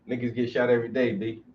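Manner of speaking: usual speaking voice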